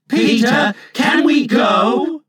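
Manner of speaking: loud pleading shout, the last words drawn out slowly; the children crying out to Peter, begging to come along; imploring cry. A narrator's reading. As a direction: beg him loudly, stretching every word. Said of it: "they all cried imploringly".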